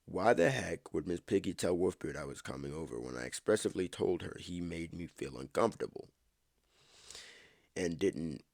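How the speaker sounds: plain reading voice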